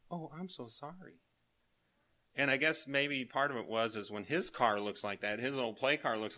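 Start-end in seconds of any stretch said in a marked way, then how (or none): 0.1-1.2 soft sorry. Retold or imitated. Grandson consoles grandpa over car.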